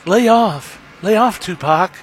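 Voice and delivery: high-pitched mock pop-star protest. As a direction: put on a high, pleading star voice. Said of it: "my best [name] impression".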